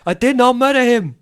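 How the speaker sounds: put-on hammy robot-movie accent on murder; iRobot impression. GM quoting his favorite iRobot line, odd accent. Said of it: "my best bit from iRobot".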